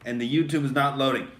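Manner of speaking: normal talking voice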